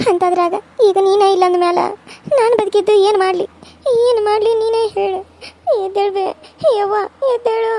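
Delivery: crying daughter begging, voice lifted; tearful pleading. Acted. Daughter begs her fallen mother to wake up, tearful pleading. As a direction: plead through tears with an unconscious mother. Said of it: "ಹಿಂಗ್ ಅಳ್ಕೊತ ಕುಂತ್ರ".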